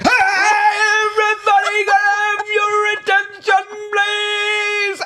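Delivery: hammed-up announcement. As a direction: belt it out like a waiter hyping a birthday. Waiter announcement imitated.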